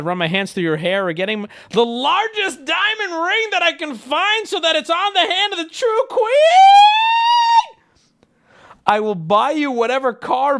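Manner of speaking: hammed-up, high-pitched yelling that keeps growing; mounting mock passion. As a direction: read the love text as an over-the-top crescendo. Love text read with mounting mock passion.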